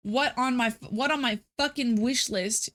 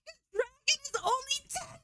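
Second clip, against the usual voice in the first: squeaked much higher and softer; shocked disbelief; 6 dB quieter than usual. Real, not acted. Streamer seeing the Yakuza price, shocked squeak. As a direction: cannot believe the price. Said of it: "'cause I got really mad".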